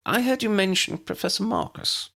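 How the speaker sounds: put-on high, squeaky tiny old wizard; squeaky little professor. A narrator's reading. Tiny professor answers the head teacher, high squeaky voice.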